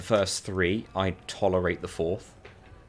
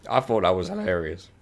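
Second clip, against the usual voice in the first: normal talking voice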